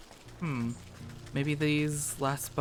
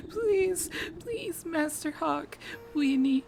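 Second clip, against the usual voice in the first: much higher, trembling plea; shaking pleading voice; 11 semitones higher than usual. Acted. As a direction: beg him in a high, frightened voice. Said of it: "a pair of shaking voices call out".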